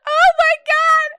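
high, slow and loud; shocked shout. Real, not acted. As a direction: gasp it out in delight. Reacting to shocking story line.

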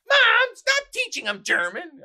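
high, loud, slow teasing protest; playful scolding. Retold or imitated. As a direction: daughter scolding her mom playfully. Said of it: "she's like".

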